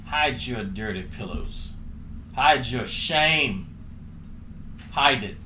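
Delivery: low, slow scolding of a shameful sight; hammed-up disapproval; mock scold. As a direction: chide like a prudish aunt.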